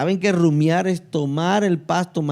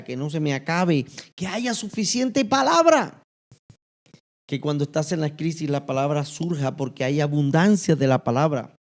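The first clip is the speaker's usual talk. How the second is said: ordinary talk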